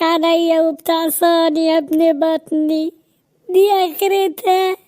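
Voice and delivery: slow, wailing mock-sob of a hurt mother; mock crying mother. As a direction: drag it out like a mother fake-crying over her son. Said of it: "بطل عياط بقى". (أم بتعيط بتمثيل وببطء)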